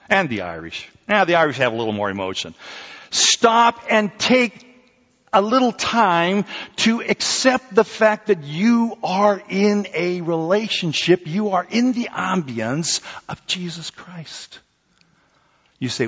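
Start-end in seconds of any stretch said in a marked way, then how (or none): 3.1-14.6 high, slow, intense sermon voice; preaching. Acted. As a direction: lean in and preach it slowly. Preaching, raised slow delivery.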